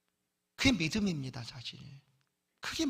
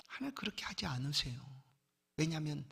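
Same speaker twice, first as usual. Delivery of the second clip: ordinary delivery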